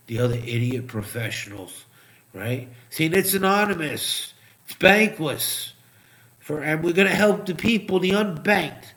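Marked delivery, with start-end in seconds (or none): 2.9-8.8 sarcastic mocking. Mocks crypto promoters' sales pitch.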